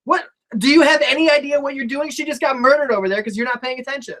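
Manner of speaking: high-pitched rant at the referee; outraged protest. Acted. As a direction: berate the ref in acted disbelief, easing slightly at the end. Acts partner berating the referee.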